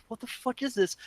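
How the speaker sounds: baffled audience member, acted out; imitated bafflement. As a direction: ask it in quiet, high-pitched confusion. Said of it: "everybody's just like".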